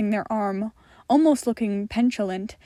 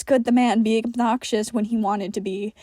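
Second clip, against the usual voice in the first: ordinary delivery, nothing marked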